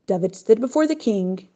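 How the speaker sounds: usual speaking voice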